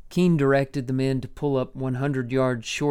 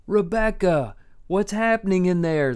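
calling out; 6 semitones higher, 4 dB louder than usual. A narrator's reading. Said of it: "a little less forcefully than before".

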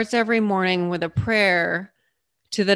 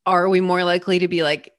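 ordinary talk

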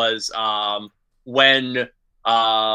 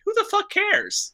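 high, scornful brush-off; dismissive; 5 dB quieter than usual. Retold or imitated. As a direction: scoff at the fuss. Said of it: "I'm like".

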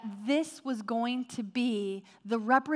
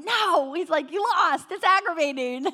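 mock protest; 6 semitones higher, 6 dB louder than usual. Husband's protest over dishes.

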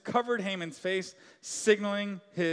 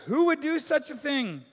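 higher, outraged demand; the king demanding who did it; upset king; 8 semitones higher, 5 dB louder than usual. A narrator's reading. King demanding answers from the queen, outraged. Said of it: "King, all upset".

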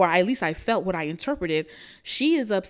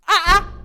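shocked yelp; 11 semitones higher, 8 dB louder than usual. Real, not acted. Disbelieving exclamation.

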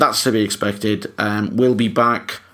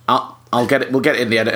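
ordinary talk, nothing marked

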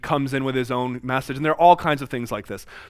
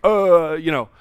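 flustered talk show host, stammering and louder; 3 semitones higher, 5 dB louder than usual. Retold or imitated. Host caught off guard, flustered and stammering. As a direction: play the cornered host fumbling for words. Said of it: "the host was totally flustered".

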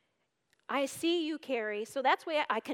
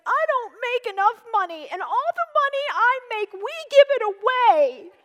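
fast, higher, loud mock complaint; imitating yelling; 7 semitones higher, 9 dB louder, faster than usual. To husband Ken about money, retold mock yell. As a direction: replay the upset outburst. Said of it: "crying and yelling to Ken".

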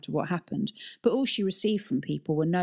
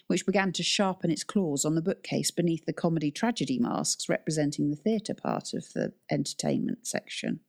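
plain reading voice

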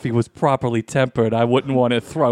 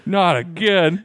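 weary teacher; 6 semitones higher, much slower than usual. Pretend. Teacher groaning at summons.

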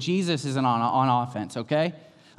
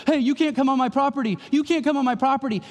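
lightly voiced higher kid protesting; a hint of a frightened boy; scared kid; 10 semitones higher, 3 dB louder than usual. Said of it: "the kid goes".

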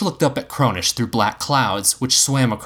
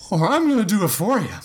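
high, strained bravado; high slurred ultimatum; slurred faux confidence; 9 semitones higher than usual. A narrator's reading. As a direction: threaten with shaky fake confidence. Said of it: "he slurred with faux confidence at Cronish".

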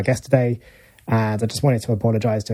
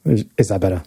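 lowered voice; 4 semitones lower, faster than usual. Shown as a demonstration. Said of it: "you had a bit of a high voice".

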